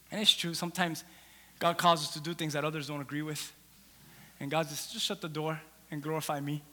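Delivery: hushed pastoral reflection; quiet preaching aside. Acted. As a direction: draw them in close, quietly.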